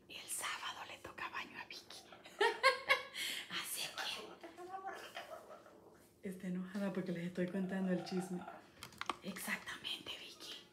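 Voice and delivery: whispered gossip. Real, not acted. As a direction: whisper the secret to the viewers. Cuenta el chisme en secreto. (susurro de chisme)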